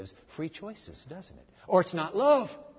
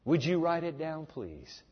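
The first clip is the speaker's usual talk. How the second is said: usual speaking voice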